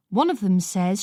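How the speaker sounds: plain reading voice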